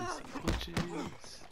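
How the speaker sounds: squeaky mocking echo of himself; high teasing repeat. Repeats the phrase differently.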